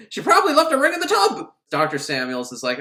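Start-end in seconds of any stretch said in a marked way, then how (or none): 0.1-1.5 loud, high, rapid mock gripe; exasperated landlady. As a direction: blurt it out in shrill indignation. Acted landlady complaint.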